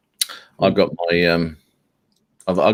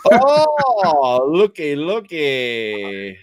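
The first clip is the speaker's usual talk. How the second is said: high, drawn-out looky looky; delighted exclaim; 8 semitones higher, much slower than usual. Real, not acted. Marvelling at the birds, high delighted looky looky. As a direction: sing it out slowly like spotting a treat.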